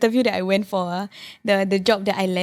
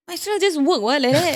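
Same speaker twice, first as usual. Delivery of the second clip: high bored musing; 7 semitones higher than usual. Retold or imitated. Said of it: "Then I was like".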